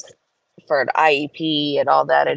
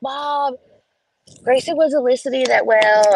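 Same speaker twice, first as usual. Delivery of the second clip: higher, tattletale brother voice; tattling kid; 7 semitones higher, 3 dB louder than usual. Retold or imitated. Zach telling mom about Grayson, tattletale kid voice. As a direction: tell on your brother.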